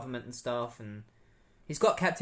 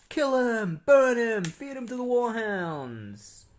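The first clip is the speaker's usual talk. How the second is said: high, loud mock crowd chant; angry mob shouting; 9 semitones higher, 6 dB louder, much slower than usual. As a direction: holler like a riled-up mob, stretching the last words. Imitates bloodthirsty crowd shouting.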